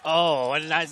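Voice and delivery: real commentator's loud groaning reaction; dismayed outburst. Real, not acted. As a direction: blurt it out at a sudden upset. Reacts to jinxing the player.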